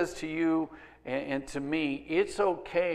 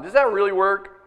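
ordinary delivery

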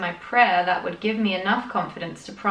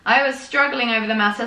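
ordinary talk

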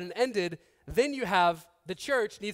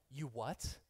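stunned disbelief; 8 semitones lower, 13 dB quieter than usual. Retold or imitated. His past self hears God spoke.

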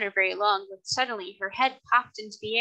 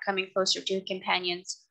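ordinary delivery

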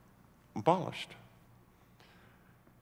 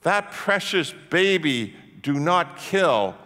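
loud, slow preacher's yell; raised voice, stretched-out words; 5 semitones higher, 5 dB louder, slower than usual. Retold or imitated. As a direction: yell it slowly, driving each word. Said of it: "who's yelling".

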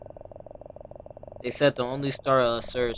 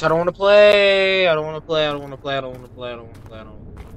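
fading plea; 3 semitones higher, 8 dB louder, faster than usual. Real, not acted. Gamer pleading to stop playing, fading out. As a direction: whimper it down to nothing.

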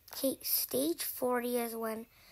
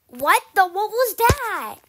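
startled shout; 8 semitones higher, 15 dB louder than usual. Real, not acted. Kid startled by a sudden game trap, loud shocked yell.

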